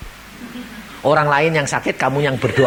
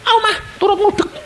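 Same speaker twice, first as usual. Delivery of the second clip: put-on eager toddler voice. Toddler voiced begging mother.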